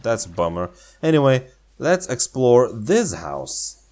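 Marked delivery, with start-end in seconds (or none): none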